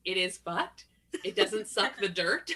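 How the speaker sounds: slow "suck the dirt", rising on "dirt"; question intonation. Retold or imitated. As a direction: end "fucked" and "dirt" each on a questioning rise. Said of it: "she says it like a question".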